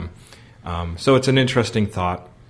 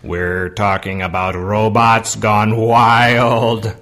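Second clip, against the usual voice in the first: slow robot announcement, building louder on the last word; 5 dB louder, much slower than usual. Pretend. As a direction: draw it out like a robot, monotone. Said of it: "what's up with the robot voice".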